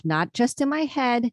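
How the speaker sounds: usual speaking voice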